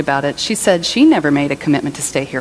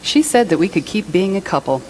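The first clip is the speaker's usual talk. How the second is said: ordinary delivery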